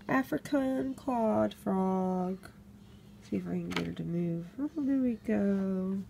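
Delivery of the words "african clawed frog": taking care over African clawed frog after a stumble; slow careful repeat. Shown as a demonstration. For the camera, carefully saying the frog's name slowly. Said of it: "Let me get that out".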